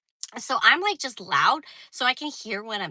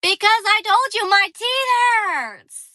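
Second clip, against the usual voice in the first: exasperated; 10 semitones higher, 7 dB louder, slower than usual. Real, not acted. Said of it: "complaining, much higher and louder".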